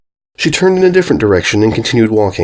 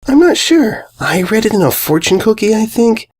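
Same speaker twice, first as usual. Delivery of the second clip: airheaded pony voice; 12 semitones higher than usual. Acted. Candy Mane voiced in story.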